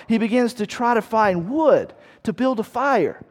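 ordinary delivery

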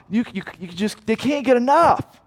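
ordinary talk

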